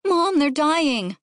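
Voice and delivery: put-on distressed kid's cry; alarmed child. A narrator's reading. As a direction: beg Mom urgently as a kid. Child alarmed over spilled tadpoles.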